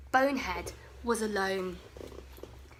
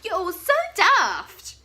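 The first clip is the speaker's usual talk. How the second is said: high, loud teasing; mocking; 8 semitones higher, 7 dB louder than usual. Bonehead teasing his friends for running, loud and gleeful. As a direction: gloat over the daft friends. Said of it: "Bonehead laughed".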